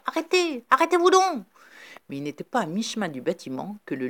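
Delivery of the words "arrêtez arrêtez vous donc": high, loud shout to stop; shouting stop across the farmyard; yelling. A narrator's reading. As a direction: yell after the departing ship. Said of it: "il hurlait".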